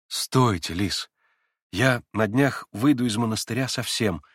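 usual speaking voice

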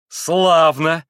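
loud vindictive exclamation; loud, malicious approval; spiteful, merciless cry. A narrator's reading. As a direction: call it out mercilessly. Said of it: "злобно и беспощадно крикнула опять Грушенька".

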